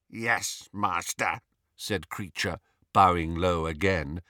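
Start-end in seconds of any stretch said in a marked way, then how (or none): 0.1-1.4 quieter croaky elf voice; grudging elf. A narrator's reading. House elf bowing to Harry's order, softly croaked. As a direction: answer softly, lifting slightly at the end.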